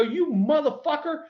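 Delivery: high, furious mock yell at the pitcher; retold furious yell. Player retelling how he yelled at the young pitcher, mock furious. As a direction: snap it out high, retelling the fury. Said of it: "I go".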